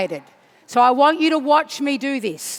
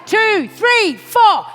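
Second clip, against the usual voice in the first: high, loud shouted count-in; 5 semitones higher, 3 dB louder, much slower than usual. Real, not acted. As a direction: count the crowd into the song on the beat. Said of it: "I will count you in".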